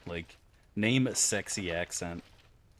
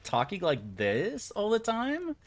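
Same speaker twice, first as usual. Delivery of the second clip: put-on drawn-out, high Cali girl voice; mock a Californian accent, slow and high-pitched; stretched, higher-pitched California caricature; 11 semitones higher, slower than usual. Shown as a demonstration. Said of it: "if you're from California and you're just".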